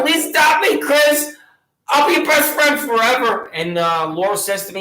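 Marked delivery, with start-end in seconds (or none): 0.0-3.5 higher, pleading puppy voice; dog's voice. Pretend. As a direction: beg like the rescue dog. Voicing the dog's plea.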